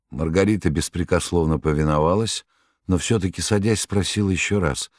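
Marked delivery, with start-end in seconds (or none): none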